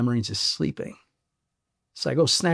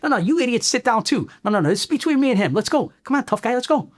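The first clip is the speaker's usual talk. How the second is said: higher, pushed, partly fast; reliving the fight, voice high; retold taunting outburst; 8 semitones higher, faster than usual. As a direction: wave off the onlookers, goad the tough guy. Telling others to sit, taunting.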